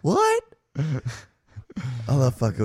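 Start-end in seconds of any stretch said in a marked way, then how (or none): 0.0-0.4 high, loud feigned confusion; mock deaf what. He pretends not to hear the girls, with a high, loud what. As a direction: play dumb, like you can't hear.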